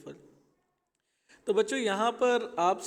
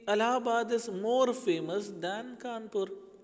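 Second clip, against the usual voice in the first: slow example sentence; 4 semitones higher, much slower than usual. Shown as a demonstration. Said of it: "तो हम कह सकते हैं".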